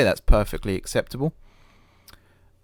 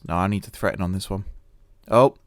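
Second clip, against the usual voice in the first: ordinary talk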